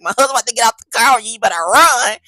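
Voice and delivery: loud, fast threat to the deputy; loud fast warning that her husband is about to get out. Retold or imitated.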